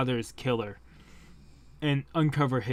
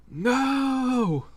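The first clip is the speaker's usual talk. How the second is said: anguished cry; 11 semitones higher than usual. Acted. Grandfather dies.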